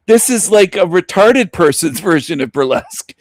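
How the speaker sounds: ordinary talk, nothing marked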